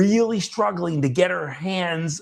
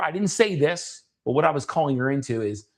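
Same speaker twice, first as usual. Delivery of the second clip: usual speaking voice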